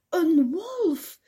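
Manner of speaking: hoog en luid uitgeroepen; geroepen. Acted. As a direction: schreeuw het hoog en luid uit. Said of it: "riep de Big". (het biggetje roept hoog en luid over de wolf)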